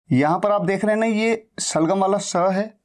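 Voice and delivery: ordinary talk, nothing marked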